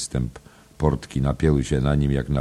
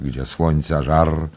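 usual speaking voice

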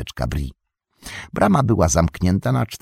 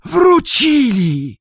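a very slow, much higher and louder yell; 8 dB louder, much slower than usual. Acted. Nob yelling to the landlord that they have returned. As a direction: stretch out the shout of news. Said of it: "wrzasnął".

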